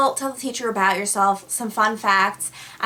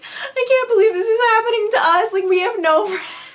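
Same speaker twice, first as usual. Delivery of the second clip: high, loud fake weeping; pretend crying; 13 semitones higher, 6 dB louder than usual. Prankster joins in with crying friend, pretend sobbing. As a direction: wail along in mock despair. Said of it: "pretend crying, and I'm like".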